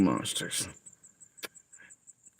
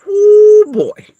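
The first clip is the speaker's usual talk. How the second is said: put-on much higher, louder, sarcastic oh boy; mock exclamation; 13 dB louder than usual. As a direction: throw it up high and loud in mock dismay.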